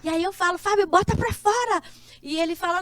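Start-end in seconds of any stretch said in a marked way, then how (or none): 0.6-1.8 high, louder exhortation; acted-out insistent push; retold urging. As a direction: urge him on, pitched up and loud. Retells urging Fábio to let go.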